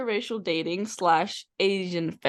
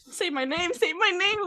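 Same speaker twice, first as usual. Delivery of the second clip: higher each time, pleading; rising mock plea; 10 semitones higher than usual. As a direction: climb higher on the repeat. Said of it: "if you say".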